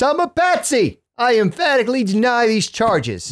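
high, formal mock denial; Oswald impression. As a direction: play the accused Oswald, hammed up. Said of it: "that's what Oswald said".

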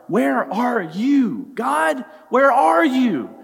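high, loud, slow questioning; anguished crying out; desperate plea. Retold or imitated. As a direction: search the sky for an answer. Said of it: "David is voicing what many of us are probably too scared to say".

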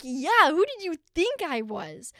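loud, high, drawn-out mock disbelief; incredulous retort. Daughter answering squinting mom who mistook her, loud incredulous retort. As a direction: stretch it out, exasperated and incredulous.

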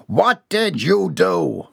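slow, high, outraged question; appalled demand. A narrator's reading. Boss demanding the truth from employee, appalled. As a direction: stretch every word in dread and anger.

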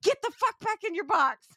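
high, hammed-up shriek; mock director yell. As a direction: screech him back into the box. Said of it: "he's like".

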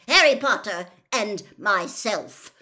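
loud, emphatic angry bark. A narrator's reading. Mister Crouch rebuking Amos, barking. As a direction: bark out each name. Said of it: "barked Mister Crouch".

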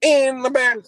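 high mock hillbilly; playful hillbilly imitation; mimicked hillbilly voice. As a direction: try out the hillbilly accent up high. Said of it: "What accent is that?".

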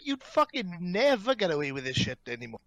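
ordinary delivery

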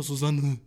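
Susanne said with the pitch sinking at the end; disappointed falling Susanne; falling name. Shown as a demonstration. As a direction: let Susanne drop down at the end. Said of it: "Es geht so runter".

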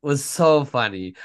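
ordinary talk, nothing marked

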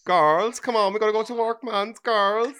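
high, loud mock Cork accent drifting Jamaican; hammed-up Cork accent calling the girls; a Cork accent slipping into Jamaican; bad Cork accent. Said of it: "that was more Jamaican than it was Cork".

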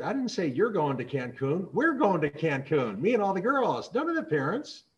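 imitating an exasperated teenage daughter; insistent teen. Daughter corrects mom, retold.

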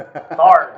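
"Fard" clipped to one syllable, farrd; Southern "Fard" for fired; southern twang. Shown as a demonstration. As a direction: drawl it as "Fard". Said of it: "that's just my southern twang".

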